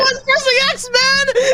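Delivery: high, loud, gleeful taunt. Real, not acted. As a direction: gloat playfully. Gleeful teasing.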